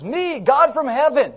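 loud, high, drawn-out declaration; boasting authority. Retold or imitated. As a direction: pull rank in a mock boast, loud and slow. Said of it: "He goes".

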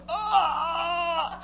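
high, drawn-out agonized cry; long comic wail of strain; pained strained groan. Acted. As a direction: groan in gut-cramp agony. Acting out chili dog agony.

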